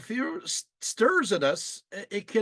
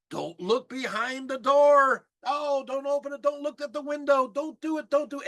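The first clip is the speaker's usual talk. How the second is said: aggressive yelling at the screen; 6 semitones higher than usual. Retold or imitated. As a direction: yell warnings at the character on screen. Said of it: "you're getting aggressive".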